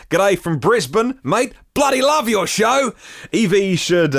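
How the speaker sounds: a deliberately bad Australian accent. Reading an Aussie listener's comment in a bad Australian accent. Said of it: "worst Australian accent ever".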